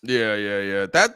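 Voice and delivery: usual speaking voice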